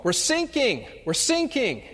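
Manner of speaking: hammed-up panicking sailor; mock distress call. Sailors call again for help. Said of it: "you hear the distress call".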